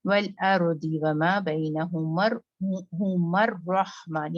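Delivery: slow recitation, हुमर रहमान joined with doubled r; बैनहुमर रहमान, r assimilated; merged r. Shown as a demonstration. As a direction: run हुमर into रहमान through the r. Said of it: "बैनहुमा रहमान नहीं".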